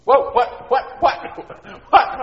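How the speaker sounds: high, loud, stammering disbelief; shocked boy. Acted. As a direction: yelp in repeated bewilderment.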